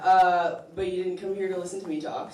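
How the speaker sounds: usual speaking voice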